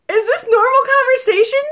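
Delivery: high, loud incredulous squeal; bewildered disbelief. Retold or imitated. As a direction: ask it in exaggerated bewilderment. Acts out bewildered reaction.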